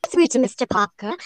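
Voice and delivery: mock British phone voice; British accent. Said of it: "British ka, Jay".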